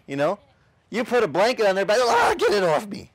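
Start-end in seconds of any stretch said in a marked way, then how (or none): 2.0-3.1 high, loud mock panic; panicked donkey. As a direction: squeal it out like a spooked donkey. Donkey panics under blanket.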